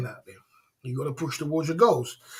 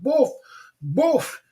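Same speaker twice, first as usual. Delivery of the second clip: usual speaking voice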